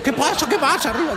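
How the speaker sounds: very high, startled squeak; hammed-up falsetto panic; mock alarm. Children startled by the hiccup.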